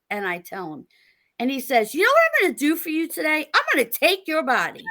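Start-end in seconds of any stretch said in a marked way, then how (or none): 1.9-4.8 quick, higher sales pitch delivery; upbeat, higher pitch, rushed salesman patter; salesman line. Retold or imitated. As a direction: sell it like a used car salesman. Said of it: "total used, used salesman line".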